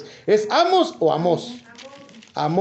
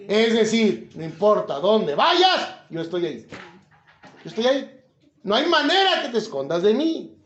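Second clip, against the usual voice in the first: usual speaking voice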